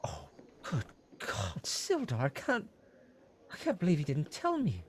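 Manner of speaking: high, hushed dismay turning to disbelief; worried innkeeper. Acted. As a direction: gasp then fret like a caring innkeeper. Innkeeper shocked at news.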